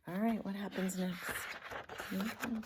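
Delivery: ordinary delivery, nothing marked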